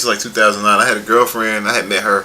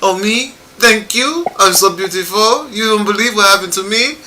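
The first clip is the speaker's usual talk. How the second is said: much higher-pitched teasing imitation; mock gullible girlfriend; 10 semitones higher than usual. Man mimicking his flattered, scammed girlfriend, high mocking voice.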